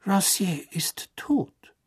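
leise, langsam, endgültig; kühl geflüstert. Acted. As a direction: trocken feststellen. Crouch trocken. (Crouch erklärt Karkaroff knapp und leise)

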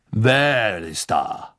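slow, wary question; suspicious. A narrator's reading. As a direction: peer into the dark warily. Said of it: "sagte Karkaroff erneut argwöhnisch".

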